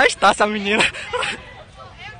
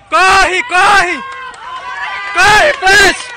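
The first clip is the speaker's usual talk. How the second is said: shouting; 6 semitones higher, 7 dB louder, much slower than usual. Real, not acted. Urging runner before gate closes.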